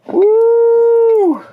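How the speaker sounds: long high whoop of delight; excited whoop. Real, not acted. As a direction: let out a thrilled whoop. Pulling out wrecked bearing.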